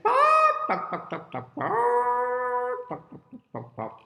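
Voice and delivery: loud high squawk dropping into quick clucks; clucking hen. Acted. Miss Hen calls out over her eggs, squawking and clucking. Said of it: "And she said".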